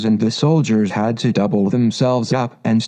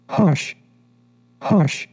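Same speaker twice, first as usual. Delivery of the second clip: higher, short hushes; urgent hushing; 6 semitones higher than usual. A narrator's reading. The Rabbit warns Alice to be careful, urgent higher hushing. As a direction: hush her urgently, pitched up.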